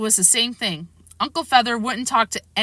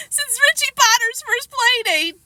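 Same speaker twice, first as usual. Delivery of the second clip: sobbing; 4 dB louder than usual. A narrator's reading. Sobbing child.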